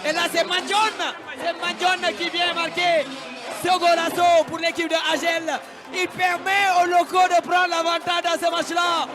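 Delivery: loud live commentary; excited play-by-play. Real, not acted. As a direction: hype up the goal call.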